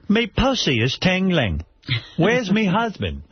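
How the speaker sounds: lightly done mock Australian accent, a little slow; Nicole Kidman impression. Mocking Nicole Kidman on air, light mock Australian accent. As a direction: tease her with a hammed-up Aussie drawl. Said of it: "That's my impression of her".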